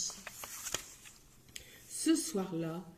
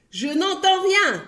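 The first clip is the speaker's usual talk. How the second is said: much higher, loud yell; 10 semitones higher, 9 dB louder than usual. Acted. Greengrocer yelling he hears nothing. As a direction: vociferate it. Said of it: "vociféra le marchand de légumes".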